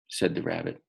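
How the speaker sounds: usual speaking voice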